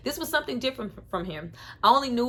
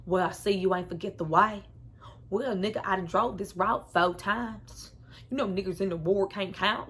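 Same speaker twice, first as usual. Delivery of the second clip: put-on Southern accent. Imitating the movie's brothers in a mild Southern accent. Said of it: "Southern accents and all".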